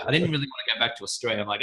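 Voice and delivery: normal talking voice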